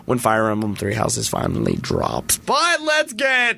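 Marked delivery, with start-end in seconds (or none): 2.4-3.6 drawn-out hype call; excited. Real, not acted. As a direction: stretch it out like a hype intro. Host hyping up the show.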